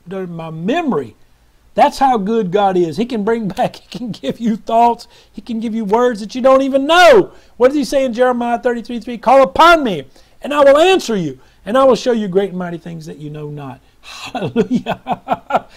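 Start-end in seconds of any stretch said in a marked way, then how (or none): 1.7-13.8 loud, high, exultant proclamation; impassioned preaching. Real, not acted. Preacher marvelling at God's gift of words, impassioned preaching. 14.0-15.7 high, drawn-out hallelujah dissolving into laughter; laughing praise. Real, not acted. Preacher delighted by a returning memory, laughing praise.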